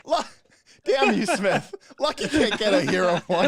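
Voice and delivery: laughing through the words, breaking up; cracking up mid-sentence. Real, not acted. Players argue over hero point.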